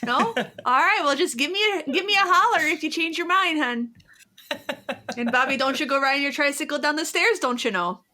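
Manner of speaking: high, sweet Midwest waitress voice with a laugh; Midwest coffee shop server. Retold or imitated. Server asking a customer about refills, sweet Midwest accent. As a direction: chirp it like a friendly Minnesota diner server. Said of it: "the Midwest coffee shop server".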